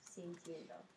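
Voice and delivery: quietly echoing "Sintiendo" for the class; soft repeat. Shown as a demonstration. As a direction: murmur "Sintiendo" back almost to yourself. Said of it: "And in the progressive".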